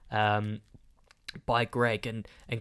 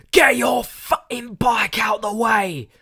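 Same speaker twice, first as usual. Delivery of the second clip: furious yell, much higher and louder; angry shout; 11 semitones higher, 9 dB louder, slower than usual. Retold or imitated. Angry man shouting at a cyclist on a train, loud yell. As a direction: bellow it at a stranger in the way. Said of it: "Shouting, the whole train can hear".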